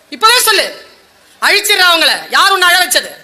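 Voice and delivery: high, loud, demanding who did it; protective outrage. Acted. Krishna demanding to know who made Draupadi cry, outraged. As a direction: roar like a protector ready to destroy.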